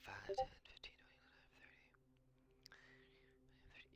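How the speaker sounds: soft counting under the breath; slow whispered tally of movement. Real, not acted. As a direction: count squares quietly to yourself. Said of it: "counting movement squares under breath".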